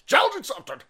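high put-on catchphrase voice; high-pitched. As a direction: shoot the pitch up into a silly voice. Same catchphrase said two ways.

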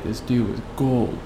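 usual speaking voice

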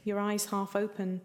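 usual speaking voice

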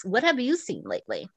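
ordinary talk, nothing marked

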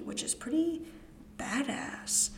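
breathy whispered aside, sly. Real, not acted. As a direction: drop to a secret whisper. Whispered aside after fact.

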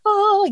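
very high, held angelic note; mock heavenly "oh". Anaesthetist arriving like a god, mock angelic high note. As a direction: imitate clouds parting with a high "oh".

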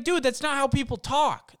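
normal talking voice

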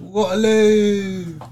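very long, high holler; loud yell. Acted. Repeating his underground stunt, long loud yell. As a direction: bellow it, dragging out the ending. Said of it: "this man's just yelling".

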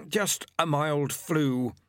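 slow, sly, offhand; Pestilence. Acted. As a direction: Pestilence excusing himself to Death. Pestilence speaks.

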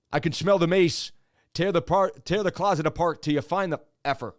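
loud imitated Irish-cop brogue. Cop ordering others to tear the closet apart, Irish brogue. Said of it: "one of the cops had an Irish brogue".

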